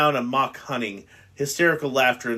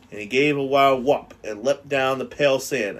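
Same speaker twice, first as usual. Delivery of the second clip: ordinary delivery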